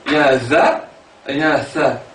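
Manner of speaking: normal talking voice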